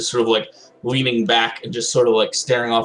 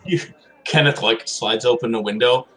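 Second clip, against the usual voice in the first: usual speaking voice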